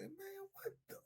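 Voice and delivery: high, soft, startled disbelief; young narrator's retold shock; disgusted surprise. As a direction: recoil in disbelief. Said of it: "I said".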